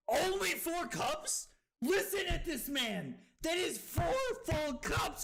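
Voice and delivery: high, emphatic disbelief; incredulous outburst. Real, not acted. Streamer confronts Cusco over four cups, incredulous yell. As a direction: call it out, astonished.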